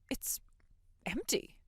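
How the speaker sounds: hushed, voiceless shock; stunned whisper. Acted. Mother finding her daughter's room empty, stunned whisper.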